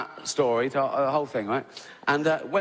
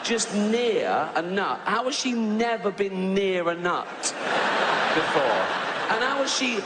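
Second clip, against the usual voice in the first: higher, rising indignant rant; exasperated rant; 7 semitones higher than usual. Real, not acted. Comic ranting about the allergic woman, higher and exasperated. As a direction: pile on the outrage at the passenger.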